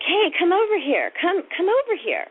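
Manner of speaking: high-pitched call to come closer; beckoning. Retold or imitated. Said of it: "motioning to me".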